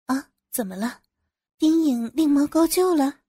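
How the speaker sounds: a lightly feigned gasp of surprise, rising at the end. A narrator's reading. Narrator fakes surprise at the boss's news, lightly feigned astonishment. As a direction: pretend to be caught off guard. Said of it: "装作吃惊地问".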